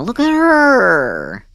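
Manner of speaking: high, slow cooing over something cute. Real, not acted. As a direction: coo at her like a pet.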